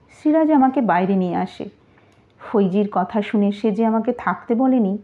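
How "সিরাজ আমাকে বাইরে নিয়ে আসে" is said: ordinary delivery, nothing marked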